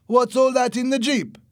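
high, loud demand; shouted challenge. A narrator's reading. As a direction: interrogate at a yell. Armed man challenges Louis.

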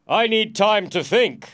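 loud, high, slow declaration; forceful stalling. Acted. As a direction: hold off the king with authority. Prisoner stalls the king.

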